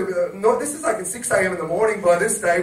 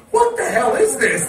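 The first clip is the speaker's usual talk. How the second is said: raised-pitch mock outrage; baffled disbelief; 5 semitones higher, 4 dB louder than usual. Friends promised an erotic show, baffled disbelief. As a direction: retell a baffled "what is going on". Retold shock at weak show.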